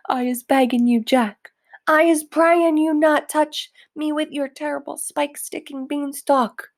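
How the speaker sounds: high, loud begging giant; a giant pleads with Jack in a nightmare; pleading yell. Acted.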